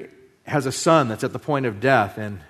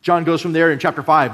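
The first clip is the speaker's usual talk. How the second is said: ordinary talk, nothing marked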